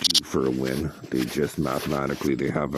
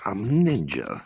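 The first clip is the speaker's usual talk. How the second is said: mock British accent, raised pitch; 7 semitones higher, 5 dB louder than usual. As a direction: say it like a cartoon Brit. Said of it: "So I got my Brit".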